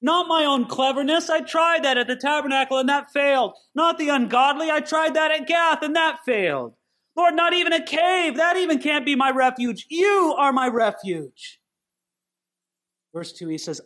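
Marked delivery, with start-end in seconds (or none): 0.0-11.6 David declaring trust. Retold or imitated. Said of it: "he repeats the idea of refuge".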